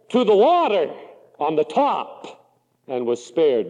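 ordinary talk, nothing marked

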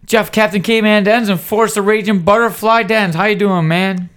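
loud, high-pitched podcast host opening; powerful, high-energy intro. Real, not acted. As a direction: launch the show at full energy. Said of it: "That was a powerful, high-energy intro".

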